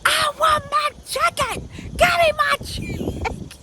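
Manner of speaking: slow, high-pitched mock tantrum; hammed-up yell, dragging out each word; retold yelling. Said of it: "I was cursing and yelling".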